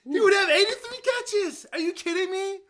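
high, loud laughing exclamation; excited disbelief. Real, not acted. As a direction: cry out the number with laughing amazement. Stat reveal, laughing amazement.

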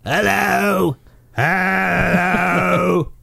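loud, slow booming shout; big booming voice. Retold or imitated. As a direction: boom it out like a giant. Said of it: "he's got a big booming voice".

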